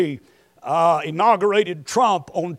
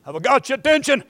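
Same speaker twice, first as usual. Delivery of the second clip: ordinary delivery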